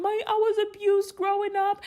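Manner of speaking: high-pitched imitation of a tearful confession; mock tearful. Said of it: "in the corner crying".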